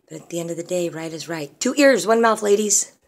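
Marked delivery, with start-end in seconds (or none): 1.6-2.9 high, loud finger-wagging maxim; emphatic lecture. Real, not acted. Mother giving women a stern maxim, loud and high. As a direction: drive the saying home.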